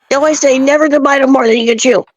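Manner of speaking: ordinary talk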